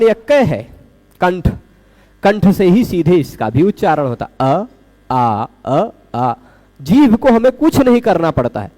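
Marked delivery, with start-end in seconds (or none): 4.5-6.3 अ said short with a small mouth opening, then आ long and open; short and long a contrast. Shown as a demonstration. Teacher demonstrating अ and आ to students, contrasting them. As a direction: alternate अ and आ to show the difference in vowel length. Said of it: "आ बोलने में जीभ ऐसे flat खड़ी रहती है".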